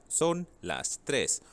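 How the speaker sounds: slow, clear "Son las tres" with "son" stressed; slow model phrase. Shown as a demonstration. Teacher drilling the class, slow and clear. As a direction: spell out each word slowly for the class. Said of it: "teacher models time".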